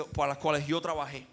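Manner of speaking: ordinary delivery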